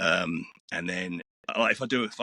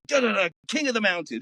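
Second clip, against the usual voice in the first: high-pitched sung-out jingle lead-in, then announcer read; mock TV promo; 11 semitones higher than usual. Hearing himself on TV, imitated high promo announcer. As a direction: imitate a dramatic race promo on TV. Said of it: "and it'd go".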